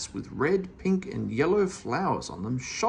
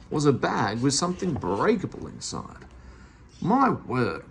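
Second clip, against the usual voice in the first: usual speaking voice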